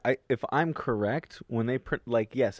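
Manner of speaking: normal talking voice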